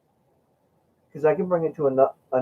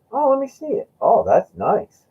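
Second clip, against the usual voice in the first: high admiring friend; 12 semitones higher, 4 dB louder than usual. Retold or imitated. Friend admires the Morgan coin.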